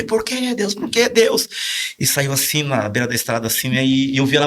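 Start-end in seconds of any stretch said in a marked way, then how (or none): 0.0-1.5 high, pleading reenactment of despair; retold anguished outcry. Man retelling pastor's cry to God at the wheel, anguished. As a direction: imitate a distraught cry to God, high-pitched.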